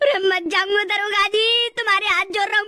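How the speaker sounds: hammed-up crying plea; tearful begging. Prisoner begging the inspector for mercy, tearful begging. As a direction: wail and plead through mock sobs.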